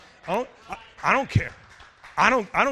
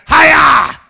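loud, high "Hiya!" yell; karate battle cry; 10 semitones higher, 18 dB louder than usual. Acted. Preacher yelling a karate cry at trouble. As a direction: shout it like a martial arts strike.

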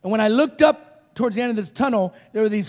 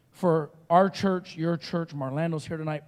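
ordinary delivery

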